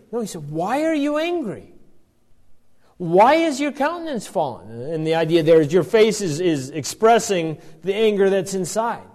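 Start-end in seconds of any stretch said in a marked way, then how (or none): 0.5-4.7 gentle reprimand. Acted. Preacher voicing God gently questioning Cain, tender and concerned. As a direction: ask it tenderly, like a caring father. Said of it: "but it's a gentle reprimand".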